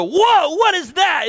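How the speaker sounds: put-on startled, high and loud kid's cry; loud surprised kids. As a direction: blurt it out as a shocked child. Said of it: "And they voice loudly".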